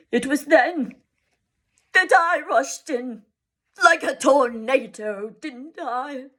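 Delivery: a father mocking his own tantrum; self-scorning. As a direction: scorn your own past temper. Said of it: "scorning himself".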